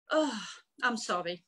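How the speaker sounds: low, tearful apology; choked up. Real, not acted. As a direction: apologise while holding back tears. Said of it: "Don't make me cry".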